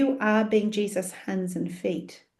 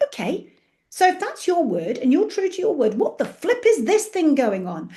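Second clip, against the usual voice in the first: high, louder indignant questioning; exasperated challenge; 7 semitones higher, 4 dB louder than usual. Retold or imitated. Quoting scripture back at God, exasperated and loud. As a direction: demand an answer, pitched up and pushing. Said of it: "I quote at him and I'm like".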